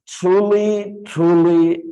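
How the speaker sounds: "Truly, truly" each pitched high and leaned on; emphatic repetition. Shown as a demonstration. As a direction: make both "truly" ring out for emphasis. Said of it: "it is for emphasis".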